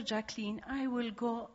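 usual speaking voice

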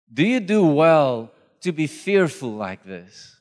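slow, weighty questioning with long pauses; Dio. Acted. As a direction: press each question home, loud on "Do you do well". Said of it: "it's like God standing in front of each".

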